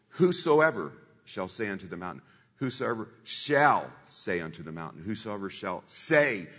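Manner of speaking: repeating a verse with heavy emphasis; stressed scripture recital. Shown as a demonstration. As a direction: chew over whosoever shall say unto the mountain, hitting the words. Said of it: "each time I went through it and I accented".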